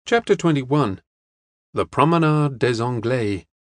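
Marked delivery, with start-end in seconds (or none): none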